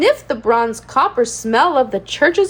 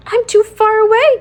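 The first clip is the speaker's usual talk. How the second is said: dismay; 9 semitones higher, 4 dB louder than usual. A narrator's reading. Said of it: "Teddy thought in dismay".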